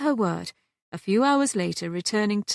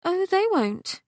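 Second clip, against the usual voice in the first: Catherine's reassurance; 12 semitones higher than usual. Acted. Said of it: "Catherine said".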